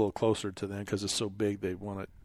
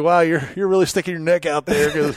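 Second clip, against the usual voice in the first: higher, louder, laughing through it; 4 semitones higher, 8 dB louder than usual. Retold or imitated. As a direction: chuckle as you admit it. Own retold remark, laughing.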